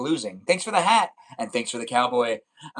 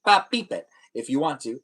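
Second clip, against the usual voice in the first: ordinary talk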